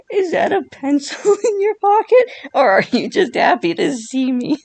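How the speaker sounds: mock flirty voice. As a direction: camp it up like a cheesy flirt. Cheesy pickup-line joke voice.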